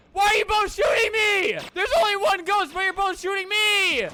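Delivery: very high, loud strained protest; outraged screaming. Real, not acted. Player shot by both teammates, outraged screaming. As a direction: scream it in disbelief at both shooters.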